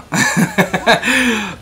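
high giggle after admitting nerves; nervous laugh. Real, not acted.

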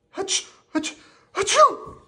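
high building ah-choo; two small build-ups, then a loud sneeze; high-pitched character sneeze; acted sneeze. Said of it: "Rocky sneezed".

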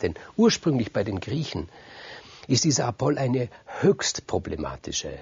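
plain reading voice

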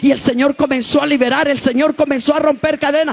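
loud, high fiery preaching. Acted. Preaching at full force. (predicación encendida)

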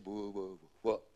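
acted stutter; stammered reply. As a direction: stumble on the first sound before the word. Captain answers, stuttering.

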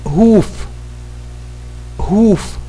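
crisp classroom drill; pronunciation drill. Shown as a demonstration. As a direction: say each word like a teacher for the class to repeat. Drill continues.

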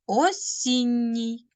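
«Осінній» slow, the double Н held long; long doubled N. Shown as a demonstration. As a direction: stretch «Осінній» so the НН is heard. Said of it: "Кореня та суфікса". («Осінній» повільно, з подовженим «нн»)

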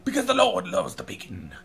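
mock evangelist. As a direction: turn a burger order into a booming sermon. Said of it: "walk up to the counter like".

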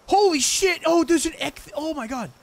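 sudden high shout of surprise; shocked shout. Real, not acted. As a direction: blurt it out, stunned. Sudden find in game.

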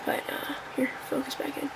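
unvoiced whispered aside; whispering. Real, not acted. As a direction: whisper it under your breath. Aside while phone listens.

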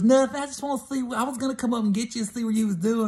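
high, fast, caught-out excuse; innocent girlfriend. Retold or imitated. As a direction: play it innocent, rushing the excuse. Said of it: "And she goes".

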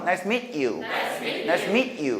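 "nice to meet you" with meet held shut; unlinked "nice to meet you"; cut between words. Shown as a demonstration. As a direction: stop on "meet" before "you". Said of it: "끊어서".

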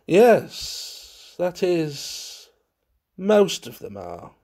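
halting, strangled reply; stifled murmur. A narrator's reading. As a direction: squeeze the words out with breaks between. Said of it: "murmured in a stifled voice".

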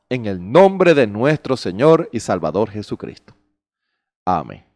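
low, soft closing prayer. Acted. Pastor closing a prayer over the congregation, soft and low. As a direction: pray it quietly, winding down to amen. Pastor prays before the sermon.

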